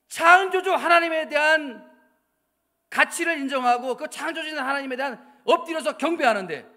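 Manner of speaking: loud, driving sermon voice; fiery preaching. Acted. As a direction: preach it out with full force. Preaching at full force.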